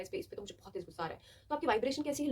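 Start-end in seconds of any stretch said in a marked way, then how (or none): 0.0-1.2 fast, low-key angry muttering; mock angry outburst. Shown as a demonstration. Showing viewers how an angry person talks. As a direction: rattle it off, stewing in anger. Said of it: "आपको गुस्सा आता है".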